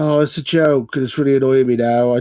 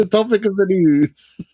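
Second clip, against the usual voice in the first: mock announcer; 8 semitones higher than usual. Mock-repeating the segment title.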